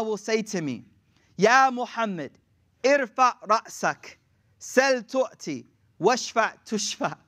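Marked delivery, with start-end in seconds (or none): 1.3-7.2 slow, spaced-out Arabic phrases; solemn recitation. Acted. Allah addressing the prostrate Muhammad, slow and solemn. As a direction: recite deliberately, pausing between phrases.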